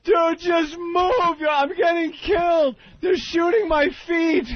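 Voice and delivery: high, slow, teary whining shout; frustrated crying plea. Retold or imitated. Mimicking the gamer whining at his teammate, slow teary shout. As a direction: beg like you're about to cry. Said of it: "he just gets so frustrated".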